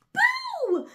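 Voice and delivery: sudden high shout of boo. A narrator's reading. Mouse jumps out to scare elephant, high loud shout. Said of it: "shouted a little gray mouse".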